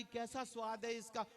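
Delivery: normal talking voice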